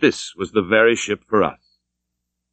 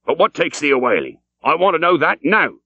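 put-on a gruff old sea captain, pitched higher, pressing the question; demanding old captain; 6 semitones higher than usual. A narrator's reading. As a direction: challenge the stranger like a suspicious old skipper. Captain insists.

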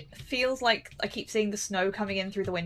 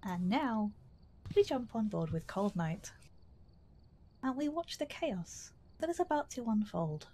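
announcer narration; 12 dB quieter than usual. Acted. Narrates like a show host.

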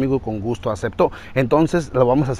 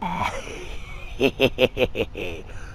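low, soft villain chuckle; villain laugh; 3 semitones lower, 8 dB quieter than usual. Acted. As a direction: laugh darkly after the proclamation. Villain laugh ends monologue.

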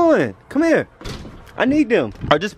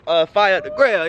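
normal talking voice

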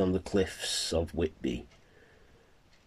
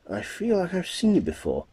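woman's voice; 13 semitones higher, 4 dB louder, faster than usual. Acted. Character line, high put-on voice.

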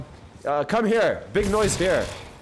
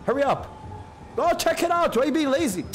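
frustrated yelling; 11 semitones higher than usual. Real, not acted. Gamer yelling at a lazy AI teammate, exasperated. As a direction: hurry the lazy ally up.

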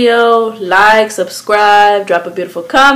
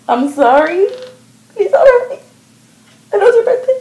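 high, squeaky begging, getting louder; hammed-up frightened pleading; 12 semitones higher, 6 dB louder, faster than usual. Friend confessing about the dog, high mock-scared begging.

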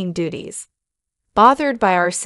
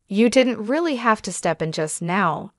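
ordinary delivery, nothing marked

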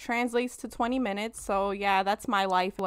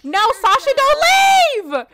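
calling out; 10 dB louder than usual. Real, not acted. Calling after her Sim leaving.

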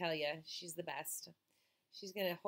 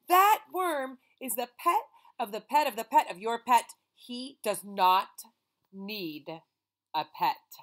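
loud, firm, slowing down on "does not need"; scolding mother; 10 dB louder than usual. Acted. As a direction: lay down the law word by word. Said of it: "cried the boy's mother".

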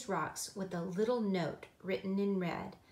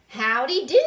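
shouted greeting, much louder and higher; Aunt Olga; 4 semitones higher, 14 dB louder than usual. A narrator's reading. Aunt arriving to visit, hearty shout. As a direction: holler a hearty hello. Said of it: "shouted Olga".

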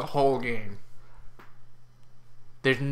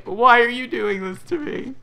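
higher, louder mock plea; voicing the old disc; 10 semitones higher, 5 dB louder than usual. Voicing the sputtering PS2 disc, mock despair. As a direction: plead as the dying disc. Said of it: "It's like".

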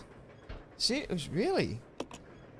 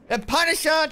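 excited call-out; 10 semitones higher, 9 dB louder than usual. Real, not acted. Calling out donor name.

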